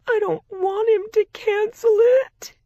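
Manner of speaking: high, loud tearful wail; weeping. A narrator's reading. Younger sister pleading through tears with sister, weeping. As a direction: sob the words out, pleading. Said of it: "weeping again".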